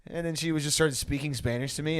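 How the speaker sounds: ordinary talk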